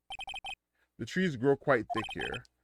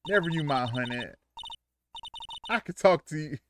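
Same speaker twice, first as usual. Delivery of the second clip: sweet Southern honey; Southern accent, kindly. Acted. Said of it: "Why they make her Southern?".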